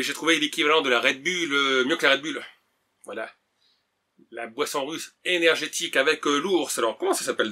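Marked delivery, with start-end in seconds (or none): none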